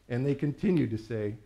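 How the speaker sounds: ordinary delivery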